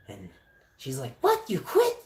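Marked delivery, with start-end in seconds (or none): 1.2-2.0 high, loud outraged disbelief; imitated mad mom. Mom learns son quit his job, high angry outburst. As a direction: mimic mom's shrieked shock. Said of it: "she was so mad that I quit".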